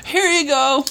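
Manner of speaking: high, bright falsetto offer; mock cheerful. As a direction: hand it over in a sing-song high voice. Mock handing diploma to doomed recruit.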